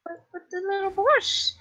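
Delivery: high-pitched character voice; comic falsetto-like Herbert impression. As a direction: put on a high, creepy Herbert voice. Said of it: "not even doing the Herbert thing".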